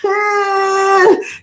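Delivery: high, drawn-out effort noise; mock struggle under a load; straining groan. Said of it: "talking about some".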